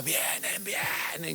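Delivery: ordinary talk, nothing marked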